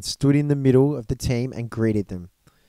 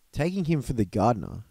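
plain reading voice

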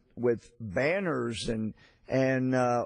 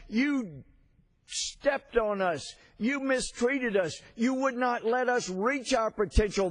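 much higher, impassioned mock yell; retold yell; 8 semitones higher than usual. Young singer answers Russian patriarch, high impassioned retold yell. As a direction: pitch it up into a fiery, defiant outburst. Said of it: "Ukrainian singer yelled".

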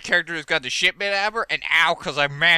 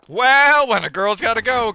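sassy quip; 7 semitones higher, 7 dB louder than usual. Pretend. Jokes about bathroom trip.

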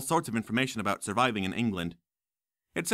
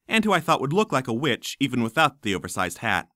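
ordinary delivery

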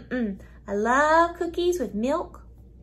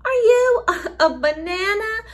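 mock surprise; 8 semitones higher, 7 dB louder than usual. Mock surprise at banana claim.

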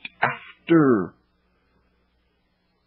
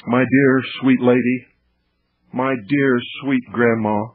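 ordinary talk, nothing marked

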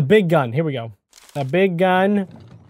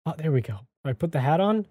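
ordinary delivery

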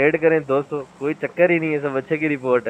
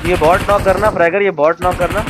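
higher, louder urgent order; loud callout; 5 semitones higher, 7 dB louder than usual. Real, not acted. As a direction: shout the target to a teammate.

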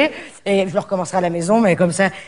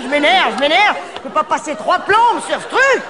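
rising pitch, slowing into a high rant; getting worked up; 7 semitones higher, slower than usual. Real, not acted. Fed up with recording the greeting, ranting ever higher. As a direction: let the irritation climb high and slow. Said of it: "je suis énervé".

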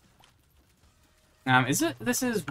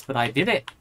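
usual speaking voice

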